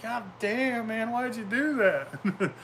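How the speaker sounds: hammed-up teary whimper from a big wrestler; mock crying wrestler. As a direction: imitate a tough guy about to cry, slowed. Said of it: "wanna belt over crying".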